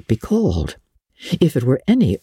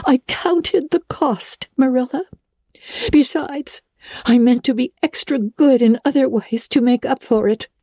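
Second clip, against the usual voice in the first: put-on high, earnest girl's voice; girl explaining why she dyed her hair; 13 semitones higher than usual. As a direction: justify it with conviction. Anne defends her choice.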